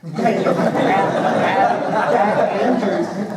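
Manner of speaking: breaks into loud laughter mid-sentence; laughing. Real, not acted. Retiring airman laughing to chief about old grudge, loud laughter. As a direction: laughs through the memory, words stretched out.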